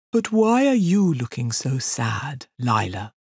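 slow, ponderous sympathetic question; concerned elephant. Acted. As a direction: ask the sad girl slowly, as a great beast. Elephant asks sad girl kindly.

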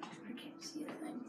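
soft unvoiced aside; whispering. Real, not acted. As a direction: mutter it under breath. Blindfolded, aside.

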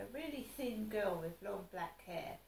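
ordinary delivery

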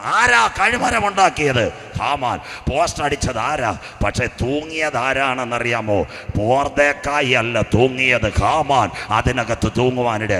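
loud, high, slowed sermon declaration; fiery preaching. Acted. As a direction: hammer each phrase to the church. Preacher at full force again.

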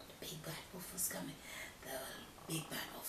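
fast, hushed suspense whisper. A narrator's reading. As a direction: whisper it scarily.

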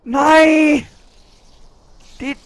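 a sharp high yell of frustration; real anguished "Nej!"; frustrated shout. Real, not acted. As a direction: yell it at the screen. Frustrated gamer yelling at screen.

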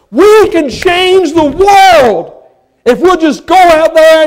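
slow, high, loud declaration; preaching shout. Real, not acted. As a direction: proclaim it slowly at full voice. Preacher rising to climax.

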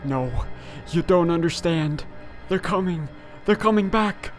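high, fearful and urgent; panicked warning. Acted. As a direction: warn them in panic. Trapped man warns of monsters.

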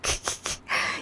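breathy whispered laugh; real giggle. Real, not acted. Streamer amused by chat, breathy laugh.

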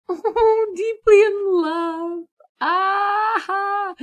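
high, slow swooning squeal; squealing delight. Real, not acted. Gamer reading Sims are in love, squealing swoon. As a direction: swoon over it.

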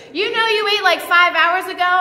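repeating the line through a laugh. Retold or imitated. Paula echoing the speaker's line, laughing. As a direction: laugh it back at her.

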